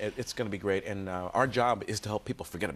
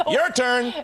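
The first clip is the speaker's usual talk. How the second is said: radio voice; 12 semitones higher, 8 dB louder than usual. Acted. Radio tuning bit.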